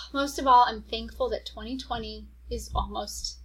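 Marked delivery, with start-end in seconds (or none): 0.1-0.7 louder, punched restart after a sigh; emphatic restart. Real, not acted. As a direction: come back in strong.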